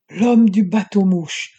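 loud startled bark; barked in alarm. A narrator's reading. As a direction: shout the recognition out in shock. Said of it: "aboya Piebald hagard".

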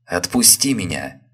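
loud sharp cry to let go; wife tearing free of husband's grip, loud cry. A narrator's reading. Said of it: "вскричала жена".

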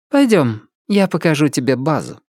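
lightly voiced woman, starting high; a high first word, easing down as she invites; woman's character voice. A narrator's reading. Emilia invites Dani to see the base, in a lightly voiced woman's voice.

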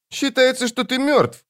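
put-on very high, childlike demon voice; Жемчуг. A narrator's reading. Demon confronts the wizard about his past, child voice. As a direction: declare it as an uncanny child demon. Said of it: "заявил Жемчуг".

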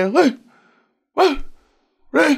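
repeated high calls of ê; a voice calling out in the night; retold calling out. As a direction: call out ê over and over, rising. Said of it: "la lên vậy đó".

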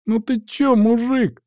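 high-pitched whimpering lad; plaintive, high-voiced hooligan; whining. A narrator's reading. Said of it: "захныкал Сарай".

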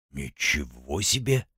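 slow, soft, impressed drawl; drawn out in quiet respect; respectful drawl. A narrator's reading. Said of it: "уважительно протянул".

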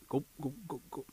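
quick repeated gulp noises; cartoon drinking sounds; imitated gulping. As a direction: mimic swallowing a drink gulp by gulp. Imitates warrior drinking potion.

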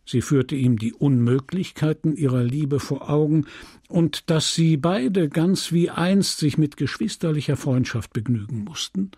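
plain reading voice

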